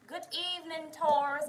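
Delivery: lifted, drawn-out evening hello; sing-song greeting. Real, not acted. Livestream host greeting arriving viewers, sing-song and loud. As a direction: call out a welcome.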